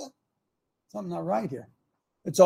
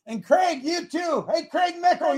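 raised, high-pitched hail across the room; calling out loudly; 10 semitones higher, 3 dB louder than usual. Real, not acted. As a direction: yell over to someone by name. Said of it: "I'm yelling over there".

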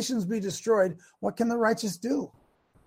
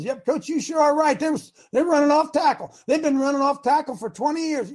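eager assistant agreeing, higher and louder; Randy; 6 semitones higher, 4 dB louder than usual. Acted. Assistant agreeing with the head coach, emphatic. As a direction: heartily back up the boss.

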